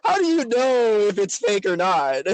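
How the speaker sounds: drawn-out, indignant "come on"; retold exasperation, stretched out; exasperated protest. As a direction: protest in mock disbelief. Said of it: "And I was like".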